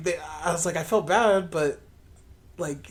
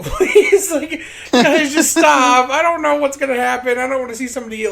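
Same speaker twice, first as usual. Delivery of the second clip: high, loud begging, starting in a laugh; mock pleading; 8 semitones higher, 9 dB louder than usual. Bystander begging fighting strangers to stop, loud mock plea. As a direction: plead for everyone to stop, hammed-up.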